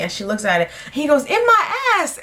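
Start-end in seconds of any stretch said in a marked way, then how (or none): 1.2-2.2 very high, cheeky punchline; imitating his shameless glee; mock gleeful brag. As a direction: crow the reveal in a high voice.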